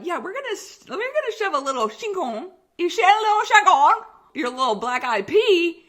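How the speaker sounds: high, loud mocking doctor voice. Patient imitating doctors explaining the procedure, high and loud mockery. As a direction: squeal it out like a silly doctor. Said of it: "And they're like".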